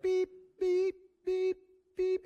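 high-pitched, evenly spaced electronic beeps; imitated metal detector beep. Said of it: "it makes that noise? It's like".

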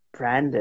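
low, quiet try at "Brandon"; softly trying out "Brandon"; name correction. Shown as a demonstration. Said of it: "I'll say Brandon".

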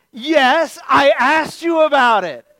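high, loud comic outburst; hammed-up indignant shout; exasperated yelling. Fooled tourist yelling back at the guide, exasperated.

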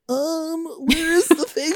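ditzy impression. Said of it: "she's, like, literally like".

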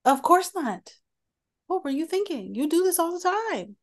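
high mocking scold; mock her scolding tone.

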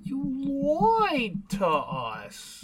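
very slow and soft, voice put on; a sulky, drawn-out accusation; slow, hushed mock betrayal; slow mock grievance.

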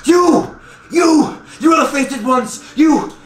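high, pointed cries at chosen saviors; frantic yelling. Acted. Ragged woman singles out the four heroes, high frantic yelling. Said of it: "she's yelling out, like".

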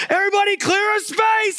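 mock panicked shout; very high, loud, hammed-up alarm; freaking out. Bystander clearing room around a seizing kid, mock panic. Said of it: "freaking out".

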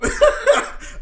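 high, loud laugh; burst of laughter. Real, not acted. Gives up attempting the accent.

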